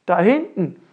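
loud burst of discovery; abrupt, loud call; sudden exclamation. Acted. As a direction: cry out as you spot someone. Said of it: "rief Ellenlang plötzlich aus".

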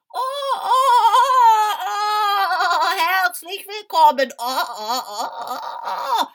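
high, loud cackling scarecrow cries; croaking scarecrow. Acted. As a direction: crow and cackle like a giddy scarecrow. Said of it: "krächzte die Vogelscheuche".

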